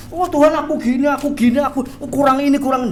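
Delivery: high-pitched mock pleading; excuse-making. Believer making excuses to God, mock pleading.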